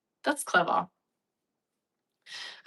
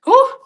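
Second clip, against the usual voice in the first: loud, high exhale of relief; breathless whew; 13 dB louder than usual. Real, not acted. Streamer winded from passionate talking, loud breathless whew. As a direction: blow out a spent breath. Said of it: "I'm out of breath from talking so passionately".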